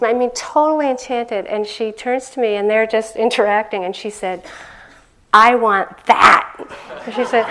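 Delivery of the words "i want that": put-on slow, loud, deliberate little-girl "I want that"; child's demand. Three-year-old niece telling her aunt, loud and slow demand. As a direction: stake your claim like a small child.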